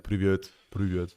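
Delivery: slowed, flat, low "Привет"; mock flat low greeting. Shown as a demonstration. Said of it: "So I'm not gonna be like".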